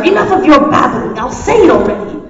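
loud, fed-up interruption; acted irritated giving-in; exasperated. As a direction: cut the babbler off loudly. Vegeta cuts off the threat.

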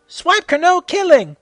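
high, loud repeated yell; yelled again. Acted. Heroes yelling at Swiper a second time.

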